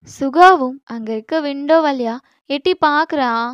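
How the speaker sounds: ordinary delivery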